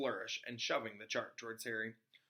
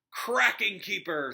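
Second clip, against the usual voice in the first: character voice; 7 semitones higher, 7 dB louder, slower than usual. Acted. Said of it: "said Fred".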